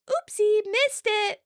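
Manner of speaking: very high, mock-innocent singsong; fake oopsie. Chandler missing the ball on purpose, mock-innocent.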